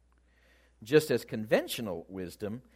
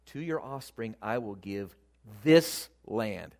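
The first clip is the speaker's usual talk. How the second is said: low start, then this punched out after a pause; measured build onto a weighty this; stress on THIS; 3 semitones lower than usual. Shown as a demonstration. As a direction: hold back, then land hard on this. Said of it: "at this point God says to him".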